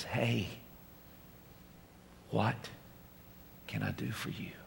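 soft, slow whispered question; tender whisper. Acted. Jesus asking the listener what he can do, tender whisper. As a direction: ask it gently, almost breathless.

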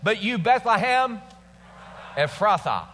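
high, emphatic scripture proclamation; dramatic flair. Shown as a demonstration. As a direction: show the congregation how to mean it. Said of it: "with a little dramatic flair".

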